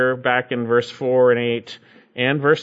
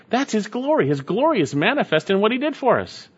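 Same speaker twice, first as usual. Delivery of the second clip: ordinary delivery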